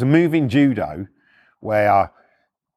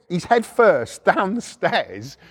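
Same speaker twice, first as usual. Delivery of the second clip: ordinary delivery, nothing marked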